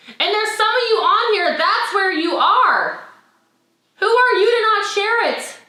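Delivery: high, impassioned rallying voice; fired-up exhortation. Real, not acted. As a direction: challenge the team with rising fervour. Fired-up motivational plea to team.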